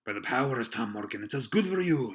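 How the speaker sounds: emphatic seaman's exclamation, rising loud; exclaimed. A narrator's reading. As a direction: exclaim it with force. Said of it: "exclaimed the landlord".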